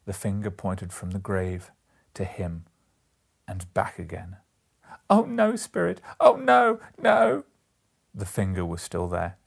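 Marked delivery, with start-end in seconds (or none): 5.1-7.4 very high, loud anguished wail; desperate pleading. A narrator's reading. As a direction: beg the spirit in terror. Scrooge begs the spirit.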